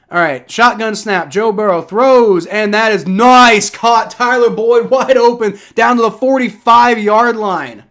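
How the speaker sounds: loud, high, drawn-out big-play call; excited play-by-play. Real, not acted. Streamer cheers Joe Burrow's completion, loud excited commentary. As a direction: build to a thrilled cheer over the catch. Calls the big catch live.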